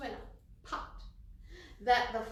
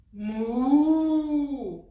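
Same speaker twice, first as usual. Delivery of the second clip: long, drawn-out louder cow moo; 15 dB louder than usual. Shown as a demonstration. Answering what the cow sounds like, loud moo. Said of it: "what does the cow sound like".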